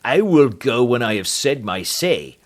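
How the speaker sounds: loud warning in a put-on heavy voice; menacing threat. The doctor threatens Holmes to stay out, menacing. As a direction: warn him off with menace.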